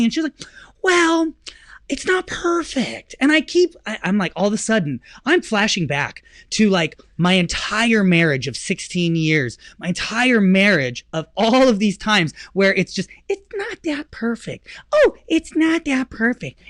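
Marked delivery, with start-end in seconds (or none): 0.8-3.1 higher, drawn-out wife voice; a lightly voiced fussy wife; mock wife. Husband mimics wife deflating his excitement, drawn out. 13.3-16.6 higher, put-on wife voice; mock nagging wife. Husband mocks years of his wife's complaints, higher.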